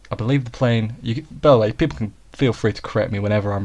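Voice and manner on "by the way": ordinary delivery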